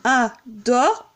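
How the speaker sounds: il adore in slow separate beats; slow, deliberate verse scansion; syllable counting. Shown as a demonstration. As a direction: scan il, a, dore one at a time. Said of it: "le E est devant une voyelle".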